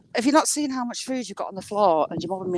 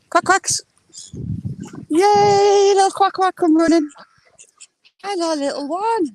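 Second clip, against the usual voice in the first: much higher, louder happy sing-song; delighted duck-calling; 10 semitones higher, 5 dB louder than usual. Real, not acted. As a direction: greet the running ducklings like babies. Ducks run over to her.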